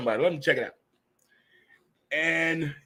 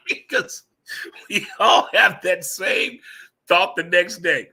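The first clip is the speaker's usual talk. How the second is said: laughing; 6 semitones higher, 5 dB louder, slower than usual. Real, not acted. Laughing at search history joke.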